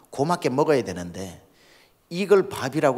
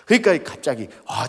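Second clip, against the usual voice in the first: usual speaking voice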